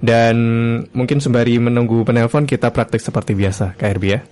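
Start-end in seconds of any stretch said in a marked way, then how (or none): none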